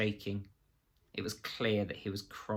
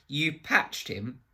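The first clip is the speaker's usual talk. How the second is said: slow, higher, mocking question; scornful disbelief; 6 semitones higher, slower than usual. A narrator's reading. Reeves mocking Absalom's skills, slow and scornful. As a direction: drag it out with scorn.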